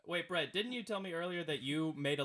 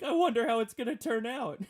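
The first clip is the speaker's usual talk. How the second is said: higher, louder, drawn-out mock curiosity; put-on suspense, pitched up; mock wondering viewer; 6 semitones higher, 7 dB louder, slower than usual. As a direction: tease with slow, exaggerated wonder. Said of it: "I just imagine you watching it".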